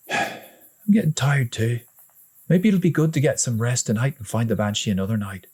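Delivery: agree, giving in tiredly; lightly voiced big brother. A narrator's reading. Older brother admits he's tired too, a touch weary.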